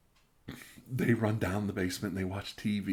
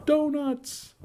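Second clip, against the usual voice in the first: a squealing, much higher "Donuts!"; excited shout; 7 dB louder than usual. Acted. Streamer reading a donation message: excited shout. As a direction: shout it with glee.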